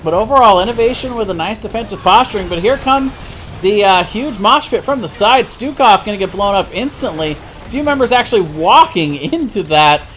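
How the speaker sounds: live play-by-play. Real, not acted. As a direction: call the team fight as it unfolds. Live play-by-play of a big fight.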